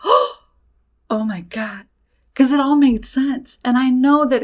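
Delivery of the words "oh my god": very slow, drawn-out awe; stunned realization. Retold or imitated. Daughter realizing she took home an attachment, stunned and slow. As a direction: let it sink in slowly.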